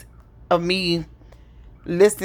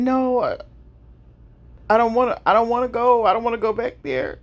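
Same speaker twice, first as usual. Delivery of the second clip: higher, frightened plea of a sick mother; pleading mother; 4 semitones higher than usual. Retold or imitated. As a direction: beg not to be sent back, voice lifted. Mother refusing the hospital, pleading.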